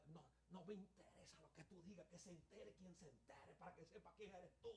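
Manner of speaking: mother whispering back defiantly to her son; hushed defiance. Retold or imitated. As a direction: talk back under your breath. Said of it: "Pero así en voz baja".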